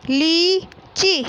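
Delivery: लीची slow, with a long ई; teacher modelling लीची slowly; stretched long ई. Shown as a demonstration. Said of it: "इस प्रकार पढ़ना है".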